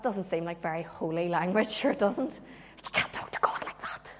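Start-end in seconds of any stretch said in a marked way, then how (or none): 2.8-4.1 hushed, scandalised aside; soft, voiceless reproof; shocked whisper. Acted. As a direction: whisper it as if scolding in church. Voicing a shocked churchgoer.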